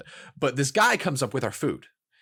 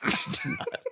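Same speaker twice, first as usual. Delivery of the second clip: squealing, high burst of laughter; a shrill cackle of delight; high shrieking laugh; 5 dB quieter than usual. Real, not acted. Wild laugh after punchline.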